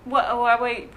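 ordinary talk, nothing marked

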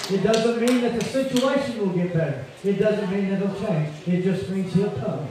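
loud, high, impassioned preaching; preaching at full force. Real, not acted. Preacher rousing the congregation this morning, loud and high. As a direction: lift the church up, full voice.

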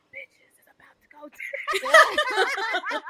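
breathy slow whisper; husky whisper. Retold or imitated. Retold drunk wall moment.

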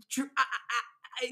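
tripping over words; stammering, halting start; stuttering. Real, not acted. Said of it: "you hear me stuttering".

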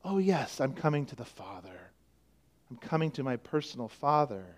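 quiet relieved recollection. Retold or imitated. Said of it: "you recall again".

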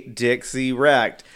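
"Dixie Wrecked" drawn out slowly, each syllable spaced; slow teasing "Dixie Wrecked", letting it sink in. Shown as a demonstration. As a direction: spell out "Dixie Wrecked" slowly so the pun lands. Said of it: "Say it slowly".

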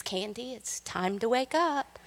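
husband gently waking his wife; sweetly. Retold or imitated. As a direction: coax her awake tenderly. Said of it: "and sweetly says".

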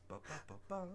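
plucked string impression. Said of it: "plucking of the strings?".

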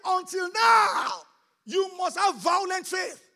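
loud, slow, drawn-out shout; preacher's shout. Real, not acted. Pastor rallying the congregation, slow loud shout. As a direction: shout it out to the church.

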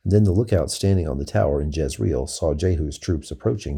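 ordinary delivery

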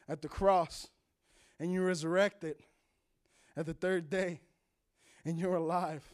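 guiding listeners through a prayer of salvation; leading a prayer. Shown as a demonstration. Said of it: "Say this prayer".

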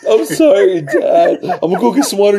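high, laughing mock apology; mock sorry teen. Son retelling his apology to his dad, high and laughing. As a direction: apologize like a scared kid while laughing.

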